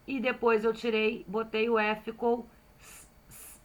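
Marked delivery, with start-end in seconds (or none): 2.8-3.6 "s, s" as separate voiceless hisses; S-sound demo. Shown as a demonstration. Teacher building the word certo, hissed S. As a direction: hiss each "s" before the word begins. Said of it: "Aqui eu tenho o som do S".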